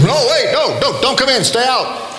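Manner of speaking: high, urgent cry to stop him; frantic calling out. Retold or imitated. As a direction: yell to keep him out the door. Students stop newcomer at door.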